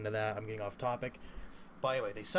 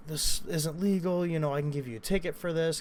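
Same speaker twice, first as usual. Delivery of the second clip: stern cop warning; 5 semitones higher, 5 dB louder than usual. Retold or imitated. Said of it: "she's like".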